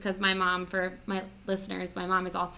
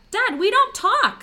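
much higher, louder, slow and exasperated; outraged retort; 9 dB louder, slower than usual. Retold or imitated. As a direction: fling it back at him, slow and loud.

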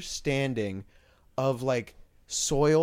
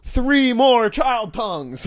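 high, loud, drawn-out mock proclamation; mock ritual shout; 9 semitones higher, 7 dB louder, much slower than usual. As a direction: call it out like a zealot demanding offerings. Mock ritual demand after "Yes!.

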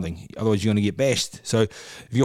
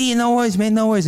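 higher-pitched Mike impression; 11 semitones higher, 5 dB louder, faster than usual. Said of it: "He goes".

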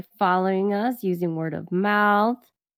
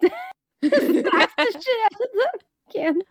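high squeal through laughter; cracking up mid-sentence; laughing; 13 semitones higher than usual. Real, not acted. Laughing while telling.

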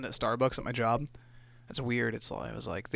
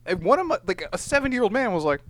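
usual speaking voice

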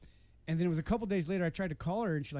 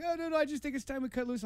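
higher-pitched imitation of her excuse; imitated woman backpedaling; 9 semitones higher than usual. Man voicing his girlfriend breaking up, higher imitated protest. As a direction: pitch it up as her, hurriedly explaining.

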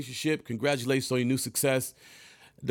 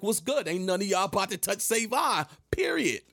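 high, sing-song tough call-out; cousin stepping up; 7 semitones higher than usual. Retold or imitated. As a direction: pitch up and rise on period as the protective cousin.

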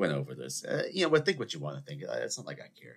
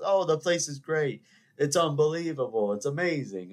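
mock gushing; 6 semitones higher, 3 dB louder than usual. Mimics diners raving about a place.

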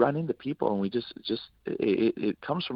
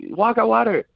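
high, loud imitated yell; big mock shout of encouragement; pushy command; 13 semitones higher, 9 dB louder than usual. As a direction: mimic a friend shoving someone into action. Acted pushy friend urging him on.

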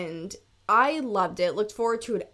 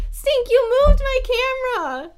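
high, loud cry at the dog; exasperated laughing yell; 13 semitones higher, 9 dB louder than usual. Real, not acted. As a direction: yell in mock outrage at the pet. Dog knocked the camera.

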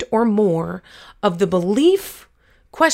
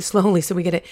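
normal talking voice

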